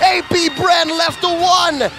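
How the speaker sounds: fast, excited play-by-play call; esports caster. Acted. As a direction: call the fight rising to a peak. Live play-by-play at full force.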